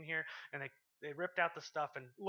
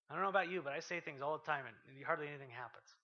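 ordinary talk, nothing marked

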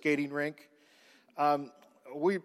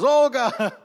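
mock Russian accent; 7 semitones higher, 6 dB louder than usual. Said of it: "I don't know what her name was".

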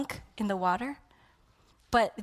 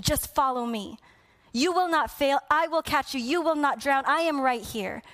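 higher and louder, full force; God's call at full preaching force; preaching climax; 5 semitones higher, 4 dB louder than usual. Acted. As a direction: lift it to a pleading peak.

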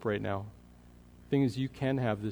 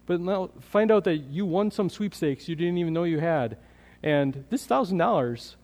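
excited; 5 semitones higher, 5 dB louder than usual. Acted. Building excitement about hope.

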